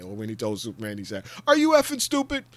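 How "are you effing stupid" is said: much higher and louder mock outburst; general's blunt insult. General scolding Superman, high loud mock outburst. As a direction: bark it at him like a fed-up general. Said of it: "his delivery kills me every time".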